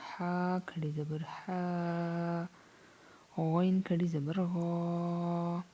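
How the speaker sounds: slow, stretched lesson chant; Qaida recitation. Shown as a demonstration. Teacher drilling letters for students, slow chant. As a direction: lengthen each long vowel.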